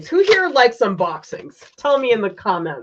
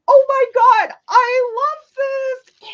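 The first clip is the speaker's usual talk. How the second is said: delighted squeal; 7 dB louder than usual. Real, not acted. Finding a red scarf from a viewer, high delighted gush. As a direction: gush over the gift.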